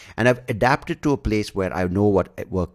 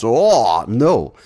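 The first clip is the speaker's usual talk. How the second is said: mock loud oh no; 4 semitones higher, 8 dB louder than usual. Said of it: "mock dismay at ads".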